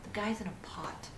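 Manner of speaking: hushed, bewildered muttering; defeated whisper. Real, not acted. Player wondering why they play this, whispered defeat. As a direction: whisper it to yourself in despair.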